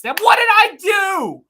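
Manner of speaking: high, loud indignant cry; mock outrage. Streamer protesting to chat, high and loud indignant.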